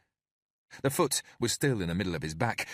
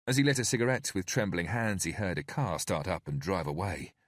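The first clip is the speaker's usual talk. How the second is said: plain reading voice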